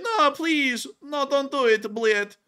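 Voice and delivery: high, hammed-up begging; pleading soldier. Russian soldier begging the drone to stop, high mock pleading. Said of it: "And he's like".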